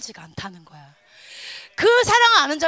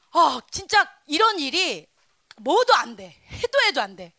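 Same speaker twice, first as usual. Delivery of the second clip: normal talking voice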